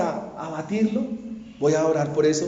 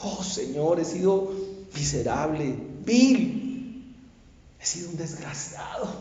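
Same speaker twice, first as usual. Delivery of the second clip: tearful confession; 4 semitones lower, 6 dB quieter than usual. Retold or imitated. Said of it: "con lágrimas".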